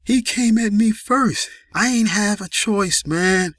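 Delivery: high-pitched wounded excuse; pained pleading. A narrator's reading. Wounded Joe explaining himself at gunpoint, high pained pleading. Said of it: "slurred from the pain".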